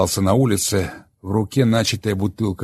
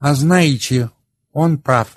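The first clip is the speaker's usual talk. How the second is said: a touch of self-surprise, higher; surprised at herself; 6 semitones higher, 5 dB louder, slower than usual. Acted. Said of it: "таким тоном, словно себе же удивляясь".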